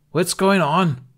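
high, loud holler. Acted. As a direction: yell up at the dragon. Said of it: "Bruna hollered".

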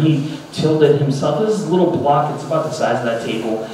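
normal talking voice

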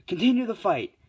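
high, loud command; ringing, high-pitched order; referee's call. Acted. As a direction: call it out to the arena.

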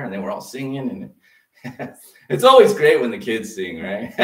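normal talking voice

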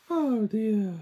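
usual speaking voice

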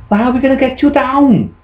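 high, rapid questioning; puzzled, fussing parent. Acted. Parent asking how to get him down, fast and high. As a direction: rattle off the worried question. Father questions stuck farmer.

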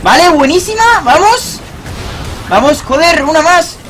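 pumped-up gamer yelling; excited cheering. Real, not acted. Cheering team on after kills.

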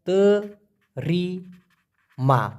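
তরিমা stretched out very slowly, syllable by syllable; slow demo. Shown as a demonstration. Teacher showing a Malay word to viewers, very slow. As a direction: drag out তরিমা for learners to copy. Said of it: "তরিমা মানে গ্রহণ করা".

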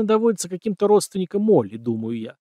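ordinary delivery, nothing marked

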